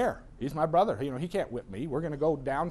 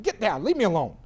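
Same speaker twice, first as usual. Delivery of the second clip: acted loud, higher dismissal; irritated father; 4 semitones higher, 6 dB louder than usual. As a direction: snap loudly like a busy dad. Busy father snapping, acted.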